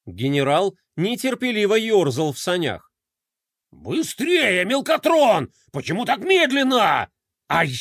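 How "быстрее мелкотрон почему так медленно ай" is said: impatient general. Acted. General rushing his slow adjutant.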